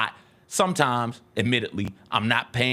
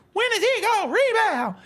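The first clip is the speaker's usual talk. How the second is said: high whiny mock fan voice, held; complaining fans. Host mocking fans' complaint, high whiny mock voice. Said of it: "complaints from Grizzlies fans".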